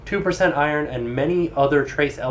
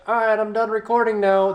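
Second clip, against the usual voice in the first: higher mock voice of the mic; microphone voice; 6 semitones higher than usual. Complaining about the microphone, mock voice of it quitting. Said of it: "it's just like".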